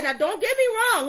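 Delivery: lightly imitating a Jamaican accent; Lisa's Jamaican accent. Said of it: "with her Jamaican accent".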